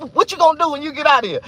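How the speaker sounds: high, loud, fast taunting question; loud high mocking guard; mock guard shout. Guards asking, quoted.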